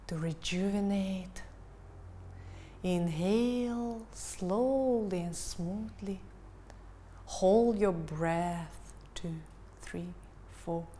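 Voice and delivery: slow, calm breathing-coach voice; guided meditation. Acted. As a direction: guide them gently into the breath. Counts breathing, held calm voice.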